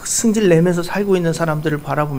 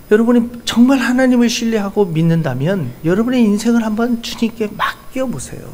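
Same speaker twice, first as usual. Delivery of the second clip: ordinary delivery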